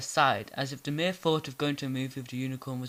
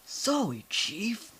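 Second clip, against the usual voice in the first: higher, bright salute voice; perky pony voice; 8 semitones higher, much slower than usual. Acted. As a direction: snap off a cheeky salute. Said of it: "Lyra, such an awesome voice".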